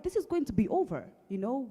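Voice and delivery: ordinary talk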